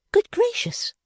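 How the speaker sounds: sudden high-pitched cry of alarm; a young lady's shocked high exclamation; cried out high. A narrator's reading. Fanny suddenly missing her sister, cries out high. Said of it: "cried Miss Fanny all at once".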